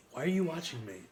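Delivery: ordinary talk, nothing marked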